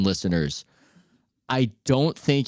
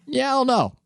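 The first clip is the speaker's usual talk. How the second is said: doubtful reply; 10 semitones higher than usual. Acted. Host reacting to praise of the French accent, doubtful. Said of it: "And I go".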